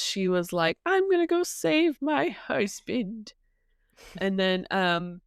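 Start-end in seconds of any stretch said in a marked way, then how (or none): 0.8-3.3 lightly voiced old woman, a touch higher; a hint of a quavery ninety-year-old; old lady voice. Nienor vowing to save her husband, lightly old-lady voiced. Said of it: "Why do you make her sound like ninety?".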